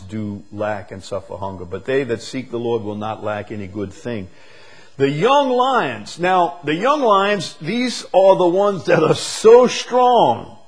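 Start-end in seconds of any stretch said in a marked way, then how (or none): 5.0-10.6 high, loud, slow preaching; preaching at full force. Real, not acted. Preacher exhorting the congregation about young lions, loud and slow. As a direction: lift the hall with it, slowly.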